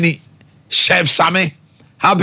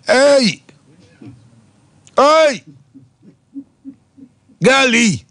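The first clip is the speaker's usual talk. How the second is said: calling out; 8 semitones higher, much slower than usual. Real, not acted. Calling out birthday greeting.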